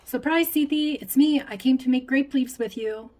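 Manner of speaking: high, loud surprise greeting; excited announcement. A narrator's reading. Lena surprises her grandma at the door, excited. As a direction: burst in with a loud, high hello.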